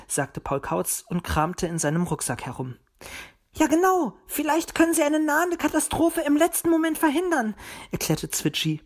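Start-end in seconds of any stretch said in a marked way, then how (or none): 3.6-7.5 much higher, eager little bird. A narrator's reading. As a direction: pipe up eagerly, high-pitched. Excited little bird pleads for help. (hohe, eifrige Vogelstimme)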